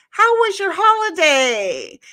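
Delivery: high, loud, drawn-out singsong; mock cheery neighbor. Neighbor calling to her in front yard, mock cheery. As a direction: call it over the fence, slowly. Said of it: "she's like".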